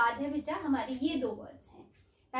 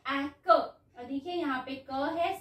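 ordinary delivery

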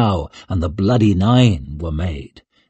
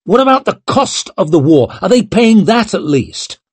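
high, heated questioning; indignant demand; 9 semitones higher, 5 dB louder than usual. A narrator's reading. Young lord demanding war costs be paid, indignant. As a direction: push the questions out in outrage.

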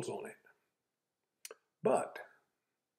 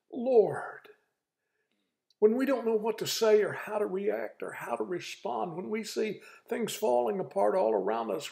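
pleading preacher's prayer, pitched higher; fervent preaching prayer; 9 semitones higher than usual. Acted. Preacher praying for the country before his viewers, fervent pleading. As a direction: lift it up to the Lord in earnest.

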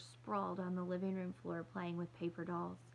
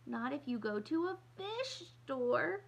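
put-on higher, louder, slow child's yell; bellowing; 7 semitones higher, 4 dB louder than usual. Little girl bellowing at her sister about fish shoes. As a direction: bellow it out in outrage. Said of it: "Kirsty bellowed".

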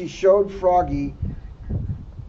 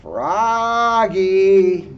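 drawn-out louder call; 3 semitones higher, 6 dB louder than usual. Acted. Mother calling Froggy from across the pond, louder. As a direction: call him from the bank. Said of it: "called his mother".

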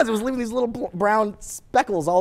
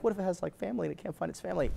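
very soft imitated pleading; worried girlfriend; 13 dB quieter, faster than usual. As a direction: fret quietly over the creature.

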